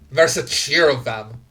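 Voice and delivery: ordinary talk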